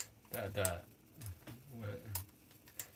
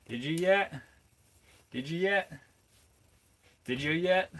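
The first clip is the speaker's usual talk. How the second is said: "Did you yet" in a higher, louder Appalachian accent; hammed-up Appalachian "Did you yet", higher and louder; mock Appalachian accent; 8 semitones higher, 8 dB louder than usual. Shown as a demonstration. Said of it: "my Appalachian accent".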